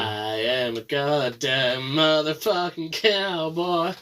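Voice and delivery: put-on slow drawling southern cowboy accent. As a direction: play it as a slow, swaggering Texas cowboy. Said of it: "the only one who can do a southern accent".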